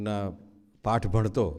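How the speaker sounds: normal talking voice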